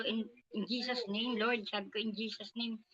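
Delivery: softly praying in Jesus' name; quiet prayer. Retold or imitated. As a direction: pray under your breath for protection. Praying quietly against the employer.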